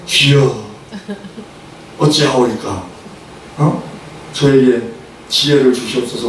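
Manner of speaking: low, earnest acted prayer; solemn petition dropped in pitch; believer's prayer. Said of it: "예수 믿는 사람 같으면".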